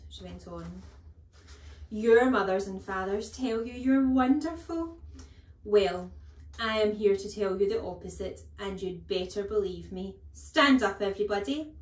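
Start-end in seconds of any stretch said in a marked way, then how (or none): 1.9-11.7 loud mocking speech rising to a command; bullying headmistress at full voice; scornful headmistress. A narrator's reading. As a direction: sneer loudly, then order the class up. Headmistress mocks and orders class.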